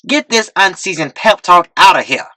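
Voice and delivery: high, scornful shout. A narrator's reading. Another pimp shouting to throw T-Real out, scornful. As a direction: heckle him out the door. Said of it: "Another shouted".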